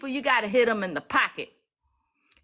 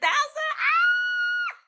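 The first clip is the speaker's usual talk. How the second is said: sudden high shriek of joy; excited scream. Real, not acted. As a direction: scream out in disbelief at the number. Shocked by follower count.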